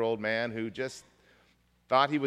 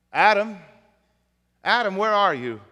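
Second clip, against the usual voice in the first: loud, raised call for someone missing; 6 semitones higher, 9 dB louder than usual. Acted. God seeks Adam in the garden, calling out loudly. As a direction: call out high and loud, searching for someone hidden.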